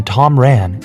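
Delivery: usual speaking voice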